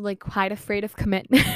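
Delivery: ordinary talk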